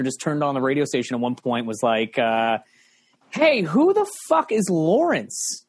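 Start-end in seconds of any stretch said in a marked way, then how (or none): 3.3-5.6 indignant boss. Retold or imitated. Program director catches him, retold.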